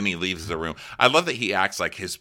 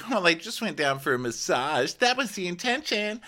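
much higher, innocent mock voice; coy Ashley; 14 semitones higher than usual. Host mocking Ashley's excuse to the crew, high coy voice. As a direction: play it falsely innocent and coy. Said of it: "And Ashley's like".

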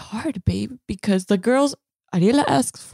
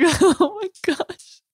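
laughing; 9 semitones higher, much slower than usual. Retold or imitated. Laughing at the forgotten wallet.

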